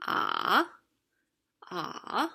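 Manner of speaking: ah dipping low in the middle then back up; third tone. Shown as a demonstration. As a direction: dip ah down and bring it up a little. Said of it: "The third tone is ... very low in the middle".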